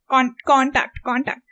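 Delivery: "contact" as CON-tact, tact left light; stress on CON. Shown as a demonstration. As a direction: lean on the first syllable of "contact". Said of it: "I stressed more on con".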